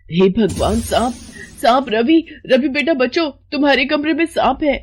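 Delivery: high, alarmed cries for help; panicked warning. Acted. Mother warning her son of a snake, panicked cries. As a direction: call out in sudden terror.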